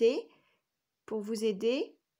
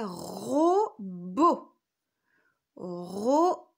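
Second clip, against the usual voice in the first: robot in two loud, drawn-out syllables; dictation sounding-out; 6 dB louder, much slower than usual. Shown as a demonstration. Teacher giving children a new word to write, syllables stretched apart. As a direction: dictate robot slowly, RO then BO.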